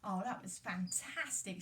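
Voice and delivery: ordinary delivery, nothing marked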